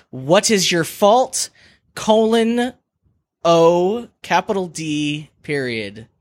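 slow, reading out each symbol; dictating. Shown as a demonstration. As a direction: spell out the punctuation slowly. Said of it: "the question is".

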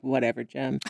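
low, quiet, unimpressed brush-off; dismissive. Acted. Fed-up viewer answers crying Jem, dismissive. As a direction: drop the voice and wave it away.

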